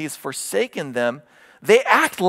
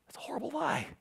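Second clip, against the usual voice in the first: hushed whisper on the verdict; 11 semitones higher, 14 dB quieter, faster than usual. Real, not acted. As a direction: breathe it like a secret warning. Preacher refuting lies.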